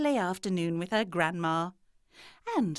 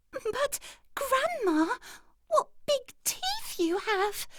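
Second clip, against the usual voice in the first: put-on very high, slow, quavering little-girl voice; frightened girl; slower than usual. A narrator's reading. Girl scared of the wolf's teeth, high and trembling. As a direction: let the voice shake with fear. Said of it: "her voice shaking".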